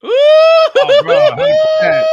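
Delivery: very high, loud shouted agreement; enthusiastic shout. Real, not acted. Podcast co-host agreeing with the guest, excited shout. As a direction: blurt out total agreement.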